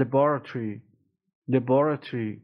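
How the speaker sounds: British "laboratory", luh-BOR-uh-tree; British "laboratory" ending in -tree; British pronunciation. Shown as a demonstration. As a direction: stress the second syllable of "laboratory", British style. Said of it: "بریتانیاییش هم".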